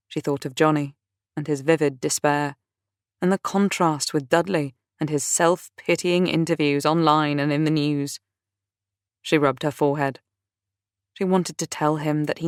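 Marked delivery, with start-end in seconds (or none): none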